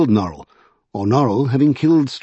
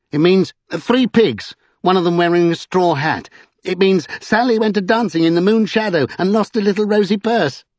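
high, mocking nonsense-spinner; teasing eccentric; 8 semitones higher than usual. A narrator's reading. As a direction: tease in a high, lilting character voice. Vinculus mocks with nonsense meanings.

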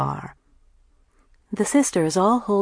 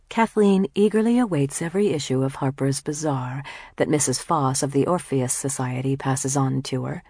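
plain reading voice

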